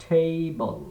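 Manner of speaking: "টেবল" as "ঠেইবল", puff on the T; "টেবল" as thei-bol, first vowel stretched; aspirated ঠ. Shown as a demonstration. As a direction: model "টেবল" with breathy T for learners. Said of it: "উচ্চারণটা হবে".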